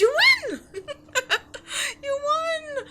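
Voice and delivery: high, loud laughter breaking into happy tears; laughing tearfully. Acted. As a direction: laugh and nearly cry with relief. Disbelieving joy at being freed.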